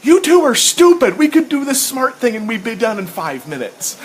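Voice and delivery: high, loud, fed-up scolding; exasperated Ariel. Retold or imitated. [name] voicing Ariel scolding Thundar and Ukla, exasperated. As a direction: scold the two dumb heroes. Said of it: "Ariel's like".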